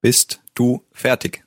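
slow, word-by-word model of "Bist du fertig?"; slow model phrase. Shown as a demonstration. Native speaker repeats the question for learners, slowly and separated. One more time for learners.

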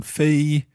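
Fei held high and level; first tone. Shown as a demonstration. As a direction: hold Fei flat and high. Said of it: "the first one is the high one".

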